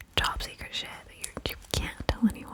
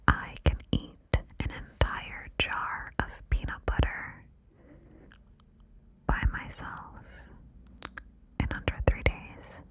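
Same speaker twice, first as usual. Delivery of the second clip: whisper in left ear. Shown as a demonstration. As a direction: close secret whisper on the left. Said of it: "the first secret I'm gonna tell you in your left ear".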